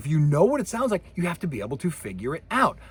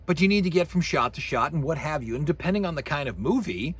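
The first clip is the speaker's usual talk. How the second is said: ordinary talk, nothing marked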